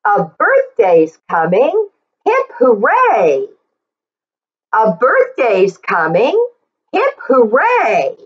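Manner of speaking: rhythmic read-aloud, leaning on each sheep; reading to children. Acted. Teacher reading the book aloud to the class, in a lively sing-song. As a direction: read it out like a picture book for little kids.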